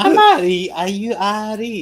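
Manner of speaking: high, hammed-up Scottish; Scottish accent. Shown as a demonstration. Streamer answering his own greeting, mock Scottish accent. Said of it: "I've got a Scottish accent I can do".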